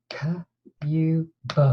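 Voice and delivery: K-U-B, each sound split apart in a plain voice; sounding out words. Shown as a demonstration. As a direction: segment cube into K, U, B aloud. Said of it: "The word was cube".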